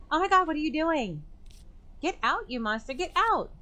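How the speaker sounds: very high, fast, frantic shouting; panicked shriek. Acted. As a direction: scream them out of the room. Clarissa shouting intruder out.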